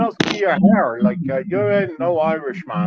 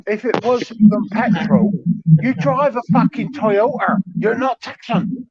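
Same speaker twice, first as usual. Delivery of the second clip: higher, slow, emphatic jeering; insistent taunt; 6 semitones higher, 4 dB louder, slower than usual. Real, not acted. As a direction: drag it out to mock him. Mocking friend's Toyota, insisting.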